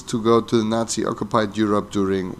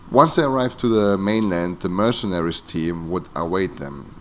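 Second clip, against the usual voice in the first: ordinary delivery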